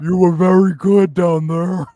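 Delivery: loud, slow put-on deep voice. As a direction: imitate a deep-voiced lover, drawn out and loud. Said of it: "deep voice".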